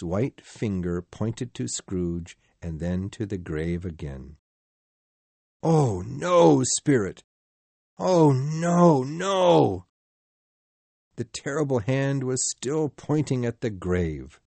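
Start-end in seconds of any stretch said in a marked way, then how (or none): 5.6-9.9 desperate cries. Acted. Scrooge begs at his grave.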